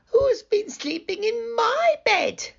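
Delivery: put-on very high little baby bear; small, high-pitched Baby Bear; tiny squeaky voice. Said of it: "squeaked Baby Bear in his tiny voice".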